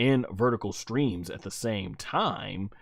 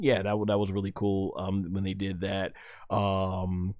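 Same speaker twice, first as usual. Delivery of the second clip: normal talking voice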